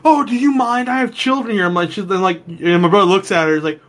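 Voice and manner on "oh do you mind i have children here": high-pitched imitation of a scandalized mom; offended mother. Lady covering her kid's ears, mock-offended mother. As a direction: play a shocked parent in a queue. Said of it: "this lady in front of him".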